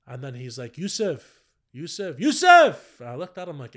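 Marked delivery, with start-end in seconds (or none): 0.8-1.4 higher, slightly louder call; retold father trying to get attention. Father calls his zoned-out son, calling out. 1.7-3.0 high, loud, drawn-out shout; insistent calling out. Retold or imitated. Father to zoned-out son, insistent loud call. Father calls again, much louder.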